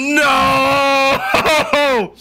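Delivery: shocked disbelief. Real, not acted. Shocked at contestant reveal.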